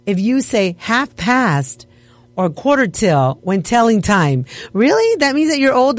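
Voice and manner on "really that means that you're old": high, disbelieving really; incredulous. Real, not acted. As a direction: squeak it out in disbelief. Disbelief at survey claim.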